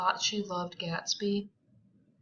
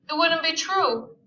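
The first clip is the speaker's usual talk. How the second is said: pitiful; 9 semitones higher, 5 dB louder than usual. Acted. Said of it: "pitiful voice".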